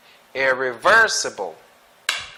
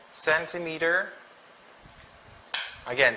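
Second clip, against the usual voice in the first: ordinary talk, nothing marked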